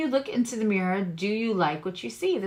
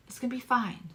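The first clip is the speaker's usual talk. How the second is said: ordinary delivery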